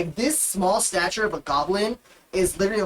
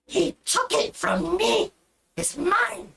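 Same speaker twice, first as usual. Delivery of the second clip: put-on high, slow shrieking goblin girl; slower than usual. Goblin girl fighting the minotaur over the satchel, high shriek. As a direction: squeal it like a greedy little goblin.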